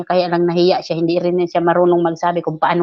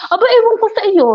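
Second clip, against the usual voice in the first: high, louder mock brush-off; sassy retort; 6 dB louder than usual. Retelling how she brushed off the coworker, sassy. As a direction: toss it back dismissively.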